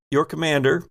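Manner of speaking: ordinary talk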